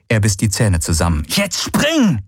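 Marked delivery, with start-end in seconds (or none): 1.3-2.2 urgent shout. Acted. Galen urging Kirai at the cliff.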